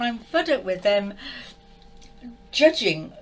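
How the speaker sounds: a loud burst of real anger on one word; upset outburst. Real, not acted. Fed up with the channelled master, bursting out loud. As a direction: spit it out, fed up. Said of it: "I mustn't get in a state".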